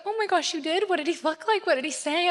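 retelling her own excited questions. Retold or imitated. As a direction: gush like a thrilled mom. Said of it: "I was so excited".

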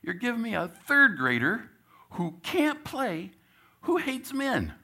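high, loud incredulous protest; mock outrage. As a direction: act out your disbelief at the raw deal. Coach protests being given Charlie.

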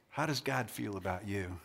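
low, very soft, sober asking; hushed question. Real, not acted. Preacher asking crowd about doubting God, hushed question. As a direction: drop right down and ask it softly.

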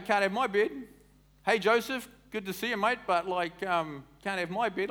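high, loud mock of stingy Bethlehem locals; mocking townsfolk. Preacher voicing Bethlehem townsfolk refusing Joseph, mock casual. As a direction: brush Joseph off, casually selfish. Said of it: "everyone's going".

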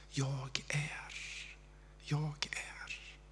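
breathy whisper of God's voice like wind; hushed sacred whisper. Acted. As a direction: whisper it, very soft, like wind passing. Voicing God's wind speaking.

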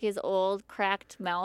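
ordinary talk, nothing marked

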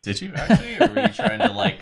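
high, real laugh through the words; giggling disbelief at a friend; laughing question. Real, not acted. Player laughing at teammate's claim.

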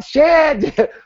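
sudden high dismayed curse. Retold or imitated. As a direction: caught out, blurt it high. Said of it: "And I was like".

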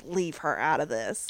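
low gravelly growl, lightly voiced; deep gravelly voice. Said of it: "a really deep, gravelly voice".

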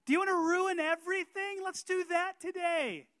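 loud, high, hammed-up tempter; Satan's bait. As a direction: ham up the tempter's blunt pitch. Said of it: "drops the hook in the water and says".

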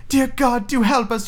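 put-on high, pleading girl voice; crying little girl. Gretel praying as she fetches water, high pleading cry. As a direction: plead for help, pitched right up. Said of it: "she cried".

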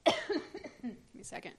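coughing through the words; coughing fit. Real, not acted. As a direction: talk between coughs. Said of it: "Stop that".

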